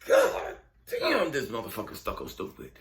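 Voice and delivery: imagined exasperation. Pretend. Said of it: "the expression of God".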